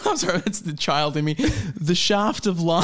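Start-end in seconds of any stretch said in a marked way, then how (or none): 1.3-2.8 slow title read. Pretend. Reads chapter title slowly.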